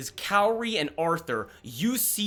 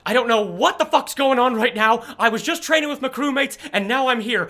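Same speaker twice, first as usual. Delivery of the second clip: put-on higher, louder, breathless young fighter; Jordan's voice; 6 semitones higher, 6 dB louder, faster than usual. Jordan introduces herself to Olive, high and loud. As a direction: talk fast and honest, a bit overwhelmed. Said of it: "pulls you in and goes like".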